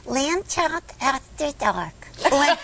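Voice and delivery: high, slow puppet voice; teasing lamb voice; Lamb Chop voice. Pretend. Puppet voice announcing show.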